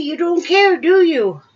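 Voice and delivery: high, loud playful question to a cat; teasing pet-talk. Real, not acted.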